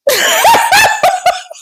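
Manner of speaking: high-pitched shrieking laughter; big squealing laugh. Real, not acted. Burst of laughter at story.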